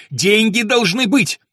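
loud, high, drawn-out insistence; angry shout. A narrator's reading. Young lord insisting to his steward on money, shouting. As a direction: shout it word by word. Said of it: "вскричал он так громко".

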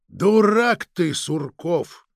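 slow, loud start, falling away on the name; exasperated rebuke. Acted. Chief calls the naive agent a fool, slow exasperated rebuke. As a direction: drag out the insult, then drop.

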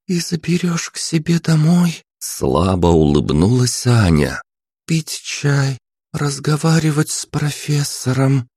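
put-on a faint hopeful girl; weak smile. A narrator's reading. Princess asks hopefully to go home with him. Narrator voices princess Anya.

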